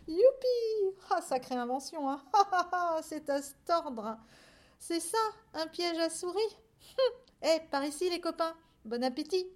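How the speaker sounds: high, loud, giggly little voices; gleeful mice. Acted. Mice laughing at the farmers' traps, gleeful. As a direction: jeer merrily between bursts of laughter.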